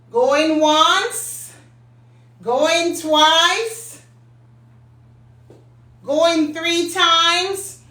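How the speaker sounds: slow auction countdown; drawn out. Acted. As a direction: stretch it out like an auctioneer.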